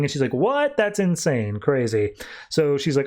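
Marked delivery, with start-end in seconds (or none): 0.3-2.1 mock disbelief. Acted disbelief in retold dialogue.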